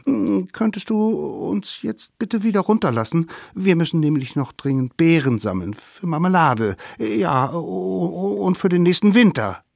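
high, wheedling little voice; pleading little savages. A narrator's reading. Savages ask mammoth to let them down, pleading. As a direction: stammer out excuses to get away.

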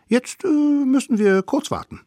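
put-on sly children plotting a trick; high scheming kid voice; little wild kids. Kids' voice again.

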